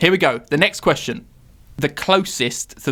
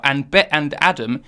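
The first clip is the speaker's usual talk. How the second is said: ordinary talk, nothing marked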